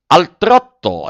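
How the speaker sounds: high, loud, slow order; shouted command. Acted. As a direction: call it out across the ring. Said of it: "il direttore gridò". (ordine gridato, lento e forte)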